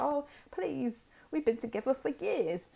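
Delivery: high-pitched mock begging; pleading ex. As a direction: plead like a desperate ex, hammed-up. Voicing an ex begging to stay.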